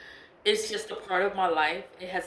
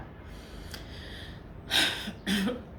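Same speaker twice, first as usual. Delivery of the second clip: long breathy exasperated sigh; weary sigh; 2 semitones higher, 5 dB quieter than usual. Real, not acted. Vlogger fed up with the spiritualist, long sigh.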